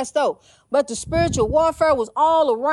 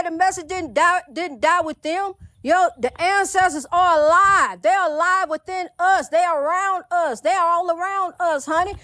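normal talking voice

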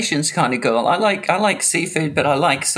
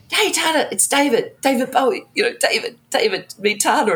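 eager introduction; 9 semitones higher than usual. Retold or imitated. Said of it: "And Iggy's like".

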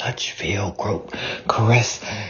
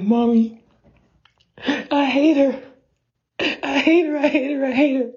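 put-on high whiny little-kid voice, much higher; high, whining tantrum; child's tantrum; 14 semitones higher, faster than usual. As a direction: stomp your feet and whine to mommy like a kid. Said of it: "As I'm stomping my feet".

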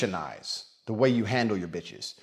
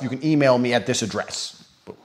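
ordinary delivery

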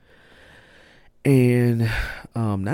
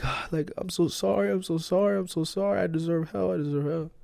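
pleading apology; 9 semitones higher, 4 dB quieter than usual. Retold or imitated. Retold desperate prayer.

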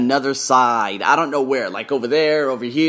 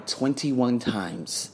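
hushed reveal; 3 semitones lower, 6 dB quieter, much slower than usual. Real, not acted. Dramatic reveal of attempts.

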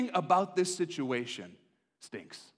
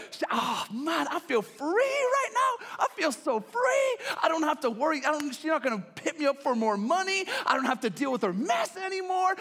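much higher, loud, shouting with glee; Hosea's gleeful celebration; 7 semitones higher, 5 dB louder than usual. Acted. Hosea celebrates his wife being gone, shouting with glee. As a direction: whoop like freedom just arrived. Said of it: "Hosea's like".

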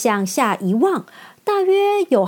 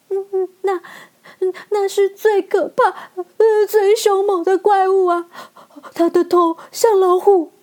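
much higher, louder sobbing panic; terrified stammer; 9 semitones higher, 4 dB louder than usual. Acted. Lion describes the monster in tearful panic, much higher. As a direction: gasp out the horror.

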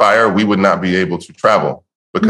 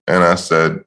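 ordinary talk

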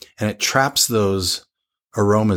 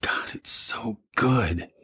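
breathy whisper of delight; slow awed whisper, so good; 2 semitones higher, 4 dB quieter, slower than usual. Real, not acted. First sip of the wine.

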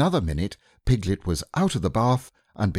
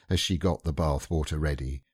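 ordinary delivery, nothing marked

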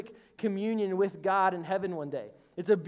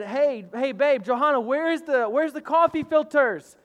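calling out; 5 semitones higher, 6 dB louder than usual. Retold or imitated. Calling out to wife.

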